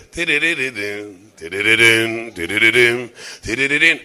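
little repeating jingle, half under the voice; imitated ride jingle. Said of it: "זה מתחיל להדין כזה".

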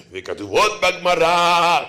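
mock old-time sermon chant; droning old preacher. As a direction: stretch it out like a tedious preacher. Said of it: "דרשנים שלפני מאה שנה".

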